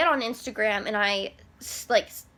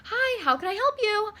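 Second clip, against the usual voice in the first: high, bright-toned operator greeting; chirpy customer service girl; 11 semitones higher than usual. Retold or imitated. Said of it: "a girl comes on".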